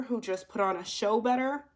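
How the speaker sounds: ordinary talk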